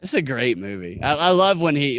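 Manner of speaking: usual speaking voice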